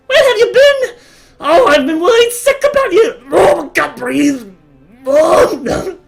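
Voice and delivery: very high, loud frantic mother; worried mother. Acted. Mother clutching her lost son, shrill and loud. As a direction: smother him with relief.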